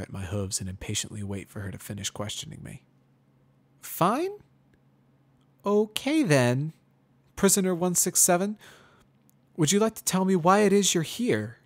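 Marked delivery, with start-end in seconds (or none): 3.8-11.6 put-on much higher, louder young mare, forced friendly; Twilight Sparkle. A narrator's reading. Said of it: "apparently oblivious to my blunt response".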